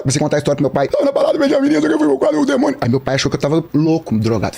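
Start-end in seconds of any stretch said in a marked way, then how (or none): 0.9-2.8 high-pitched babbled retelling; frantic son. Retold or imitated. Son telling his father about the demon, frantic. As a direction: blurt it out high, overwrought.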